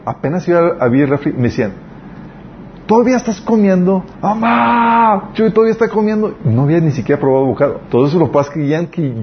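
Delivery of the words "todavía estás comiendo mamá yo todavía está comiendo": put-on tattle to mom like a sneering kid; a brat yelling for mom to tattle; mocking kids. Host kids mocking, calling mom.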